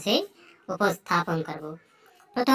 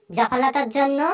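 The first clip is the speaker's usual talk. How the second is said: normal talking voice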